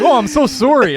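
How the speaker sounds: high, hammed-up Canadian apology; Canadian accent. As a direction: gush an apology as a mock Canadian. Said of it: "like the most Canadian".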